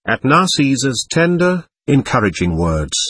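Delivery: usual speaking voice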